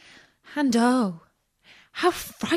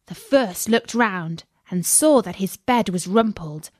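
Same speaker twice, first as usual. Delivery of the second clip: usual speaking voice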